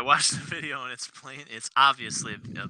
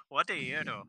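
put-on Indian accent; 2 semitones higher, 7 dB quieter than usual. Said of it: "That's more Indian".

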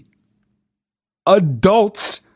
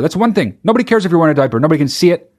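usual speaking voice

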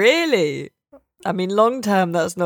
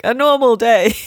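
breezy; 6 semitones higher, 6 dB louder than usual. Retold or imitated. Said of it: "So I'm just like".

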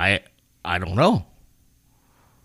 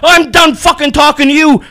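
angry shout; 11 semitones higher, 8 dB louder than usual. Retold or imitated. Dad hangs up in rage.

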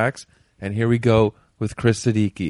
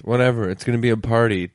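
ordinary talk

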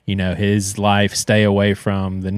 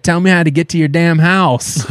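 higher, sharp mock-angry retort; irritated uncle; 6 semitones higher than usual. Grumpy uncle snapping at nephew about directions, mock-angry. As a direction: snap back like a grumpy uncle.

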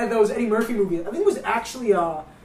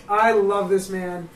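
ordinary talk, nothing marked

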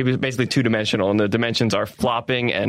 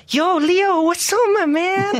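much higher, louder, slow laid-back Brazilian dude; Neymar voice; 3 dB louder, slower than usual. Retold or imitated. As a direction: chill greeting to a teammate, pitched way up. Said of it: "make up how he talks on the show".

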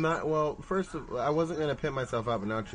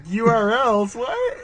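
incredulous; 11 semitones higher, 7 dB louder, much slower than usual. Real, not acted. Mock disbelief.

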